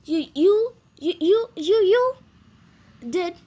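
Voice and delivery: stutter, building in pitch and volume; stammering shock. A narrator's reading. Speechless mouse to his cousin, stammering, rising in alarm. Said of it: "I stammered".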